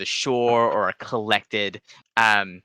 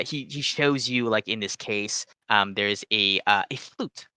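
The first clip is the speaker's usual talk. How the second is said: ordinary delivery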